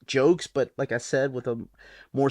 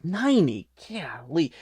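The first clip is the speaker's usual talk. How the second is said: amazed; 3 semitones higher than usual. Real, not acted. Amazed at the ninetieth anniversary.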